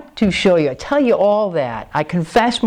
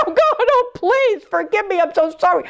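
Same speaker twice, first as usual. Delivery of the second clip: high, pleading retold prayer; anguished plea; 14 semitones higher than usual. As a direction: act out begging forgiveness, pitched way up. Acts out begging God's forgiveness.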